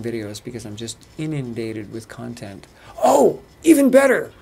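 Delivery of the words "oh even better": high, loud burst of excitement; excited exclamation. Real, not acted. Excited interjection mid update.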